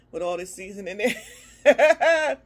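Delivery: breaking into a loud laugh; amused, laughter taking over. Real, not acted. Laughs at own calorie excuse.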